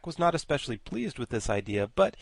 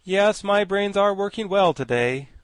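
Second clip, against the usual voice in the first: proud; 9 semitones higher, 7 dB louder than usual. A narrator's reading. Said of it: "an accent of pride in his voice".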